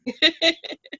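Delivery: short high giggle; quick amused giggle; bright laugh. Real, not acted. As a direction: laugh it off lightly. Amused laugh after anecdote.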